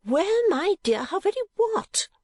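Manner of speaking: very high, baffled question; great astonishment. A narrator's reading. Mother astonished at Kate's unfinished protest, amazed. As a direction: demand it in high-pitched amazement. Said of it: "in great astonishment".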